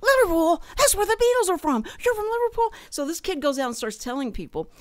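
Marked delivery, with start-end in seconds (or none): 0.0-2.7 high, loud, fast excited gush; thrilled kid. Retold or imitated. Starstruck kid hearing they are from Liverpool, high excited gush. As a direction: burst out like a starstruck fan.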